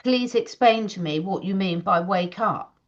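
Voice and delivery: ordinary delivery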